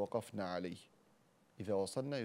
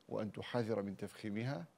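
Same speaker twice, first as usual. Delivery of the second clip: normal talking voice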